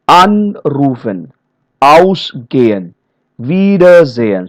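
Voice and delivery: "anrufen, ausgehen, wiedersehen" slowly, with long AN, AUS, WIE; stressed prefix. Shown as a demonstration. Trainer showing stress on the prefix part, slow stressed prefix. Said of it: "the stress is on the prefix part".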